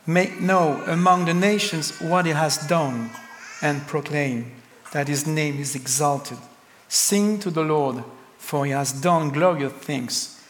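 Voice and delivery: ordinary talk, nothing marked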